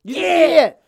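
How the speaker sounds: very high, loud mock holler; one high yelled word imitating a dad; foster dad's shout. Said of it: "King's dad will be like".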